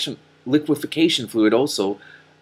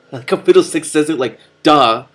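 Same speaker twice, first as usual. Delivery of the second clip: laughing aside; 5 semitones higher, 9 dB louder than usual. Real, not acted. Reader laughs mimicking the cat.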